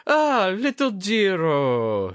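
high, slow exclaiming lord's voice; exclaiming old lord. A narrator's reading. Drunk old lord greets young Jiro, exclaiming high. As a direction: cry out the name like a tipsy old lord. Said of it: "he exclaimed".

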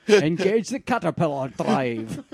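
put-on a light Connery Russian accent, slowing to the end; hammed-up Connery playing a Russian captain; imitating Connery's Russian accent. Friend quoting Connery's Russian accent, a light hammed-up imitation. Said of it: "his Russian accent".

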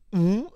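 short high-pitched "mm"; mimed mute hum. Retold or imitated. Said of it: "this fucking wacko blue dude just goes".

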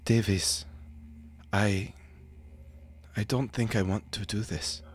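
weary and resigned. Acted. Two agents doubting their mission.

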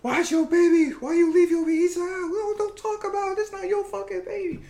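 very high-pitched squabbling voice; mock screaming ladies. Ladies screaming at each other over the baby, mock shrill quarrel. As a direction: imitate two women shrieking at each other. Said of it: "Ladies screaming at each other".

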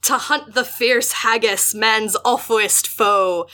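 slow, drawn-out Scottish recitation; strange Scots voice. Acted. Host reads haggis poem to co-host in strange Scots voice. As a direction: declaim it slowly in a put-on Scots dialect. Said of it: "Just, like, a strange voice".

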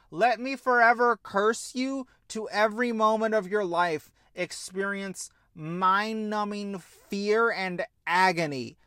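usual speaking voice

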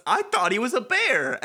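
high character voice. Acted. Voicing Fret's line.

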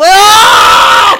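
sudden high yelp; startled shout. Real, not acted. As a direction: cry out in surprise. Sudden startled outburst mid-read.

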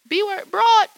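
high, loud mock insult; mock yell. Host pretends to yell at the woman who took her spot. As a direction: fling the insult at the other driver, half-joking. Imagined road rage at driver.

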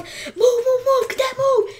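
high-pitched imitation of screamed orders; mock scream. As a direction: imitate an officer screaming at a cadet. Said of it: "He fucking screamed".

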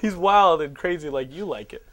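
ordinary talk

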